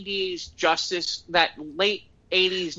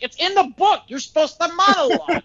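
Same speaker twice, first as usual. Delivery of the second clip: indignant insistence; 11 semitones higher, 6 dB louder than usual. Retold or imitated. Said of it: "I'm like".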